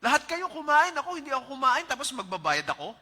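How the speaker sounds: put-on loud, high, indignant child's complaint; child's loud protest. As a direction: protest like a stubborn six-year-old who feels cheated. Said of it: "Sabi ng bata".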